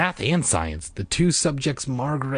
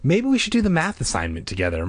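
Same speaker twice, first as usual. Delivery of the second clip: higher, quick, mildly hopeful; hopeful suggestion; 8 semitones higher, faster than usual. A narrator's reading. Margaret to Diane about homework, mildly hopeful. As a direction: float the idea hopefully and quickly. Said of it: "Margaret suggested hopefully".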